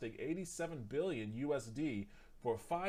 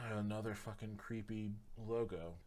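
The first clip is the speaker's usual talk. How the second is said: normal talking voice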